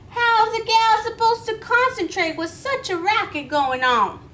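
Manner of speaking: grumpy horned toad. Acted. Horned toad scolding her noisy neighbour, cranky and loud. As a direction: complain about the noise from up on the rock. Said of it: "looked down from her rock".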